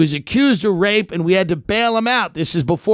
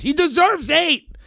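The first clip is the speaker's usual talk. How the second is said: raised rant; 9 semitones higher than usual. Acted. Fan defending his podcaster to his wife, rising rant. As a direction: voice pitched up, building toward a yell.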